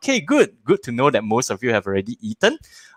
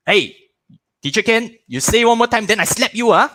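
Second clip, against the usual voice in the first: a raised, higher mock-angry parent; angry parent threat; 10 semitones higher, 4 dB louder than usual. Parent warning a cheeky child, mock-angry.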